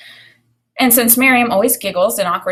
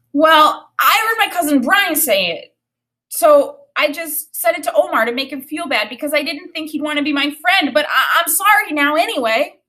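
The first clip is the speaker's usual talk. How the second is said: put-on higher, louder guilty boy; a kid owning up, words tumbling; Daniel's voice; 8 semitones higher, 4 dB louder than usual. A narrator's reading. Daniel explains his confession.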